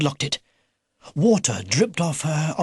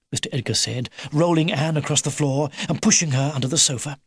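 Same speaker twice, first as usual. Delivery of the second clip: plain reading voice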